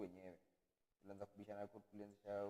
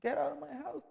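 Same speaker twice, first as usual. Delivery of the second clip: loud, slow mock yell, pitched high; parents yelling; 14 semitones higher, 9 dB louder, slower than usual. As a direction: shout it like a fed-up parent. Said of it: "It is the parents that are telling you".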